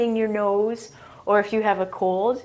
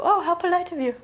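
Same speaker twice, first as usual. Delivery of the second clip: pleased polite praise; 8 semitones higher, faster than usual. Retold or imitated. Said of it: "They just smile and go".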